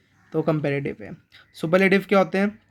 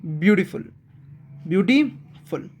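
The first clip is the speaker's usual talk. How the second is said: Beautiful pulled apart as beauty, then फुल; two-part break. Shown as a demonstration. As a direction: break beautiful before फुल to show the syllables. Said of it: "दो parts में break करके बोलना".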